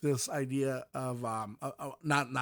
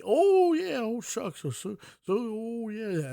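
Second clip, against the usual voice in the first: mocking caricature voice; 9 semitones higher, 4 dB louder than usual. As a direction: overdo the folksy caricature, high and bright at first. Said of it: "why does it have to be, like, a character?".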